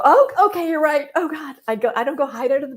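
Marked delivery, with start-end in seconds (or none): 0.0-1.6 high, loud frightened giving in; mock panic. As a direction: cave in like a scared owner.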